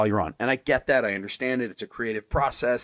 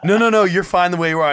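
loud, eager no no no; insistent reassurance; 6 semitones higher, 6 dB louder than usual. Retold or imitated. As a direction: insist warmly he's fine. Fan reassuring him loudly.

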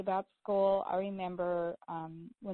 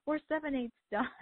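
mock proud mom; 7 semitones higher than usual. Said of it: "and she goes".

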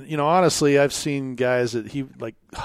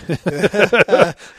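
amused laugh; 7 semitones higher, 12 dB louder than usual. Real, not acted. Laughs at his own story.

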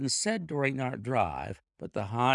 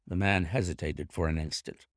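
usual speaking voice

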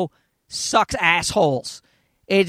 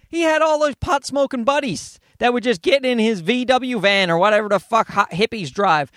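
ordinary delivery

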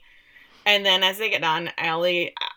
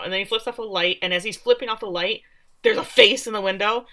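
usual speaking voice

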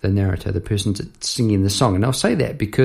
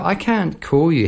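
title read with weight on cant; announcing the song title; song title recited; 6 semitones higher than usual. Shown as a demonstration. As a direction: cant stressed, falling at the end. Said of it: "All right, here we go".

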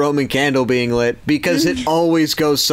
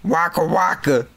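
Fozzie Bear voice; 3 semitones higher than usual. Pretend. Muppet impressions, Fozzie catchphrase.

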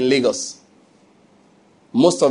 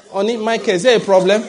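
higher, sweet "Honey, Michael"; wife's voice; 6 semitones higher, 3 dB louder than usual. Pretend. Preacher voicing a wife, higher and sweet. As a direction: lightly play the worried wife. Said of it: "Your wife will say".

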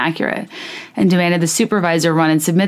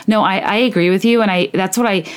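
ordinary talk

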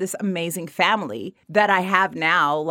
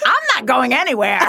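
higher and louder defiant retort; pissed off; 5 semitones higher, 5 dB louder than usual. Retold or imitated. Retelling how she stood up to her boyfriend, a raised defiant retort. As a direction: plant your feet and refuse to budge. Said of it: "I got so pissed".

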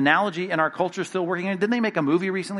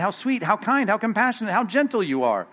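mock sweetness; 6 semitones higher than usual. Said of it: "mock praise of gentle Jesus".

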